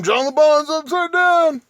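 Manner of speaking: high, loud taunt; a loud, high-pitched jab; mocking shout. Real, not acted. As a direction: jeer it out gleefully.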